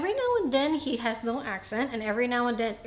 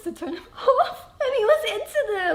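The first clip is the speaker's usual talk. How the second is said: laughing; 8 semitones higher, 10 dB louder than usual. Real, not acted. Laughing through a story.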